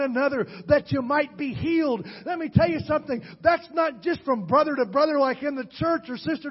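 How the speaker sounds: high, loud revival preaching; fiery preaching. Acted. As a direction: lift the congregation up, pushing hard. Preacher builds to full force.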